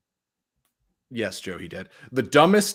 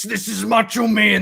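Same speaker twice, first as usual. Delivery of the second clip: Macho Man impression; 8 semitones higher, 7 dB louder than usual. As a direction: ham up the wrestling promo voice. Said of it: "he talks like this".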